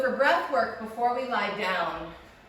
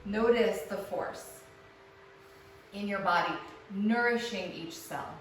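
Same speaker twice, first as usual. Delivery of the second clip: guided meditation. Acted. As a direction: ease them into stillness. Guided relaxation lying down.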